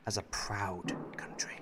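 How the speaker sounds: breathy whisper under the breath. Acted. Husband reading his tweet to himself in a whisper. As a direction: mouth the words to yourself.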